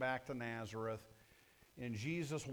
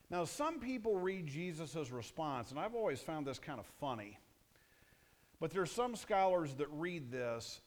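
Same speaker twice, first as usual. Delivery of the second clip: normal talking voice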